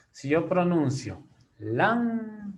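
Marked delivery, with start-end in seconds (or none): 1.6-2.5 "lámpara" with LÁM drawn out and pitched up; stress on first syllable. Shown as a demonstration. Teacher showing where the word's force of voice falls, stressed first syllable. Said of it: "en dónde lleva la mayor fuerza de voz".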